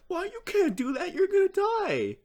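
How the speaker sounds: squeaky high panicked cartoon voice; high-pitched panicked character. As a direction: shriek it up high in alarm. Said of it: "he's like".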